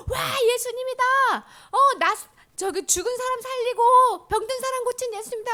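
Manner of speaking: high, loud, thrilled shouting; excited crowd. Acted. Crowd spotting Jesus, calling out in high excited cheers.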